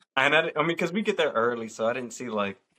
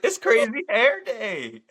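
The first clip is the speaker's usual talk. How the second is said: slow, high, over-stretched mock explanation; high, slow, exasperated retelling; drawn-out announcement; 12 semitones higher, 3 dB louder, slower than usual. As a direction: stretch each word as if spelling out the obvious. Repeats the explanation to teachers.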